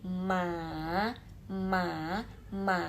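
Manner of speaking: MA shaped like a check mark, down then up; "Ma" dipped and drawn out; dipping third tone. Shown as a demonstration. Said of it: "seperti checklist".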